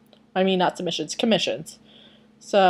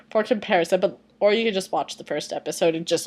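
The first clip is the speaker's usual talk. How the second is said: usual speaking voice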